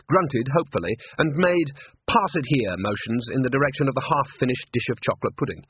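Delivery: plain reading voice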